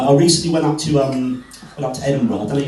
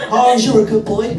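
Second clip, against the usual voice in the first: praising the dog; 8 semitones higher, 5 dB louder than usual. Retold or imitated. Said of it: "that's his name".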